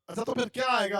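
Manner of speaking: usual speaking voice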